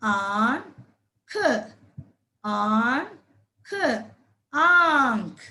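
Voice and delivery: आंख drawn out very slowly, long vowel held; slow sounding out. Shown as a demonstration. Teacher stretching आंख out slowly for children. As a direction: hold the long आ for the kids to hear. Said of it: "आप भी बोलिए मेरे साथ".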